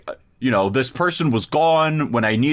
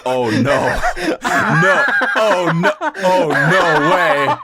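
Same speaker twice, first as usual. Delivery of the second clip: loud, high, laughing oh no way; laughing mock dismay; 6 semitones higher, 5 dB louder, slower than usual. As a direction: crack up while protesting oh no.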